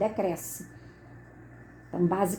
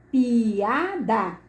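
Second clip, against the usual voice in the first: "Piada" drawn out as pi, a, da; syllable by syllable. Shown as a demonstration. Teacher showing each vowel's syllable, "Piada" split slowly. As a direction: pull "Piada" apart, one beat per syllable.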